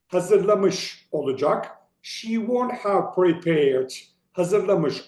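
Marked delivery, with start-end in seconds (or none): 2.0-3.9 slow, clear textbook reading; careful reading. Shown as a demonstration. Teacher reading for learners, slow and deliberate. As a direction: space out "She won't have prepared" word by word. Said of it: "önce İngilizcelerini okuyorum".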